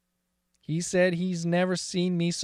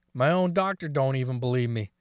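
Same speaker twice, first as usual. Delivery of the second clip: ordinary talk, nothing marked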